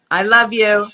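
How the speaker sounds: ordinary talk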